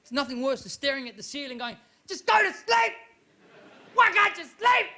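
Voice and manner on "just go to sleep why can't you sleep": high-pitched exasperated yell; yelling at yourself. Acted. Sleepless person to their own brain, frantic yelling. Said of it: "'Cause you're yelling at yourself".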